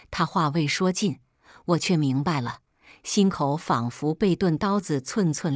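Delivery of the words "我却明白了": ordinary delivery, nothing marked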